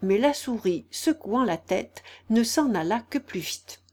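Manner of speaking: usual speaking voice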